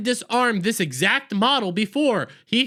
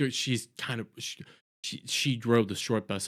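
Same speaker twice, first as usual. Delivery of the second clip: much softer, nearly breathless; whispered aside; 12 semitones lower, 9 dB quieter than usual. Real, not acted. Recapper mocking a character under the breath, whispering.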